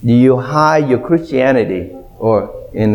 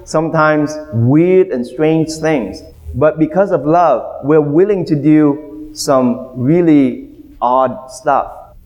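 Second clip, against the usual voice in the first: normal talking voice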